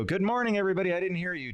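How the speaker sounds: high mock emcee hello; cheesy greeting. Mocking a speaker's tired opener, cheery and hammy. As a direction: lightly ham the cliche. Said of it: "a speaker come out and go".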